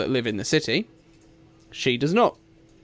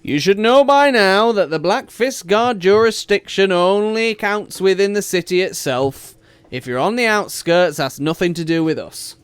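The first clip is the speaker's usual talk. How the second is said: loud, higher, bored brush-off; a guard waving off her problem; dismissive city guard; 8 semitones higher, 9 dB louder than usual. Acted. City guard brushes off the party, loud and dismissive.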